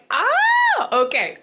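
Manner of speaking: high, loud drawn "oh", then dropping; retold realization. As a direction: act out a sudden realization, pitch leaping up. Retells own reaction to the accent.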